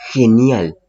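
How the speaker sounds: "genial" con G que suena a jota; G como jota. Shown as a demonstration. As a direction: pronuncia una jota clara al inicio de "genial". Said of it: "suena como una J". ("genial" dicho como si empezara con jota)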